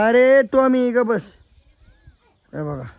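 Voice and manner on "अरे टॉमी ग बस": commanding the dog. Real, not acted. Ordering the dog to sit.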